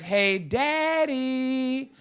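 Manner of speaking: slow, sing-song "Hey, daddy" stretched long; drawn-out kid call. Retold or imitated. Daughter in back seat calling her dad, slow and drawn out. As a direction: drag out the call like a bored kid.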